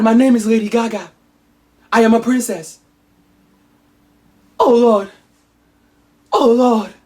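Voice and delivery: Olivia's remembering. Acted. Olivia remembering she is a princess, hammed-up and high. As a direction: overact the memory flooding back. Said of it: "Olivia Hope is like".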